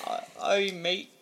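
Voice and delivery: slow, laughing mock Scottish; a half-hearted Scottish accent; bad Scottish accent through giggles; fake Scottish accent. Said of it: "That's not Scottish".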